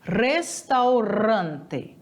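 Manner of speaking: Restaurante, very slow, both R's trilled; Spanish pronunciation. Shown as a demonstration. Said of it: "a pronúncia dos dois R's".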